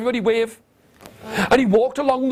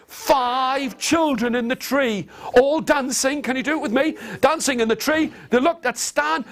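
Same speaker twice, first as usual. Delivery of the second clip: ordinary delivery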